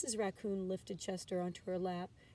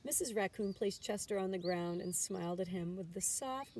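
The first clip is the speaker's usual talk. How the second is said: ordinary delivery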